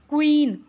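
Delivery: क्वीन with a loud, clear kw; kw sound. Shown as a demonstration. Teacher modelling the kw sound to the class. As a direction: stress the kw opening of क्वीन. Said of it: "क्व की आवाज़ आ रही है".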